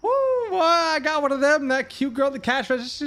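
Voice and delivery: loud, high Southern whoop; excited Southern good ol' boy; thick Southern accent. Retold or imitated. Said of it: "speaking more Southern".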